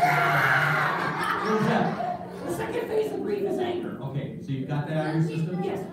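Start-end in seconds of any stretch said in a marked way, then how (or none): 0.0-1.4 hammed-up yell of rage; puppet-boy mock yell; mock angry yell. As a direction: let out a fake fury. Puppet anger stage.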